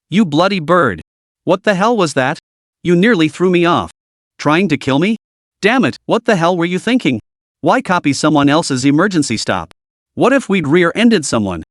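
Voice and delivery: plain reading voice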